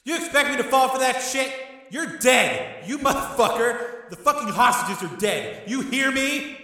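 high-pitched, loud threatening rant; furious shout. Acted. Gangster threatens the hostages' lives, furious shout. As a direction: rage down at him, threatening. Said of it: "Tommy shouted".

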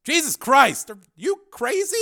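high, loud, alarmed outburst; panicked shout after a sudden fright; startled yell. A narrator's reading. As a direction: cry out in shocked disbelief.

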